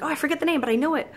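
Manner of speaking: sudden delight at a half-known song; imitating a thrilled partygoer; excited recognition. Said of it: "You're like".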